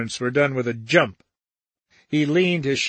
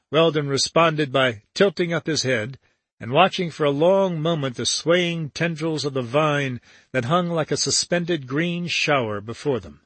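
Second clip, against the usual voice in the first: plain reading voice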